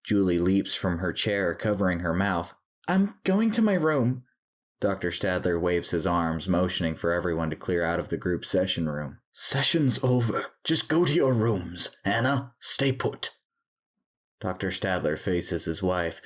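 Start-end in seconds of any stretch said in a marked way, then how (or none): 2.9-4.2 put-on very high, upset girl's voice; distressed exit. A narrator's reading. Julie flees in disgust and shock. 9.3-13.3 high, firm dismissal; commanding. A narrator's reading. Doctor orders everyone out.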